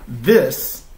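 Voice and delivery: reading a picture book aloud, voicing Gerald; reading to children. Dad reads Mo Willems aloud to viewers with Chloe, storytime voice.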